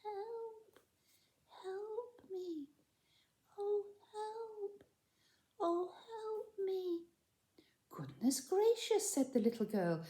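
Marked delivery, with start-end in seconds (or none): 0.0-7.1 high, soft, far-off pixie cry; a tiny creature calling faintly for help. Acted. Pixie trapped under snow cries faintly for help. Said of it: "a tiny little voice crying". 7.9-9.1 startled whisper. Acted. Girl startled by voice.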